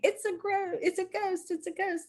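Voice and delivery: high, soft frightened squeak; mock frightened cry. Disciples seeing a figure on the water, high scared cry. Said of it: "they start crying out in fear".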